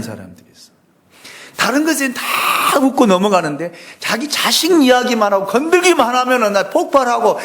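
high, loud preacher voice, building to a near shout; preacher rising to a pitch about church fights; fiery preaching. Acted. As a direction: thunder it at the congregation. Preaching at full force.

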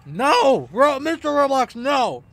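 urgent scolding shout. Real, not acted. Player tells Mister Roblox no, scolding shout. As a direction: shout it in alarm to stop someone.

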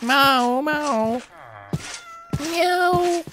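high-pitched imitation of a kitten; drawn, high kitten mewing; kitten meow. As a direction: mew like a little cat, very high. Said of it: "un petit chaton qui miaule".

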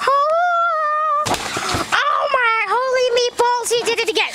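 high, slow, amazed yell; amazed shout. Acted. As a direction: cry out in wide-eyed awe. Nerd amazed at second jump.

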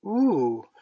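high, loud ooh of delight; delighted exclamation. A narrator's reading. Wendy hears of talking to the stars, delighted exclamation. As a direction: exclaim with rapturous wonder.

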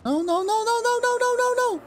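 very high rising panicked repeats; panicked pleading. Real, not acted. Gamer sees the crash coming, high panicked pleading.

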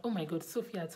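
normal talking voice

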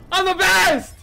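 high, loud victory cheer; triumphant shout. Real, not acted. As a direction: crow about winning. Celebrating a win.

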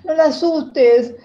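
loud, high, slow stop; urgent plea. Retold or imitated. Girl telling her brother not to scare mom, urgent loud plea. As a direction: hold him back, urgently.

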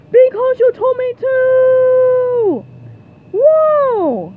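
high, loud, drawn-out yell; eager battle cry. Acted. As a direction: shout the order back like a war cry. Shouting boss's order back while vaulting.

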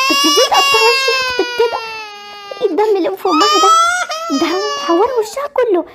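tearful dismay. Acted. Mother upset at injury.

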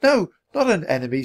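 put-on high child's protest; protesting girl. A narrator's reading. Annie correcting the centurion, protesting. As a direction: object in a small high voice.

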